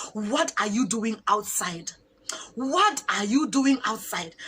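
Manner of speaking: slow, emphatic soldier's questioning; mock-stern, drawn-out challenge; imitated stern demand. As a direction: interrogate slowly, leaning on each word. Soldier interrogating her, acted.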